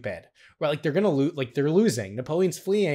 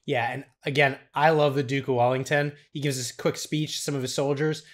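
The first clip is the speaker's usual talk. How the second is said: ordinary talk, nothing marked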